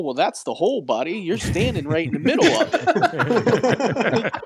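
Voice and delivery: high, loud mock indignation; retold exasperation. Acted confrontation with a hole hogger.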